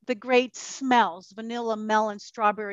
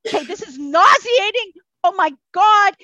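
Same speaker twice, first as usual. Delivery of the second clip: higher and louder, exasperated; overwhelmed exclaim; 6 semitones higher, 7 dB louder than usual. Retold or imitated. To herself after her friend's call, exasperated and loud. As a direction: throw up your hands at it. Reacting to a friend's bad news.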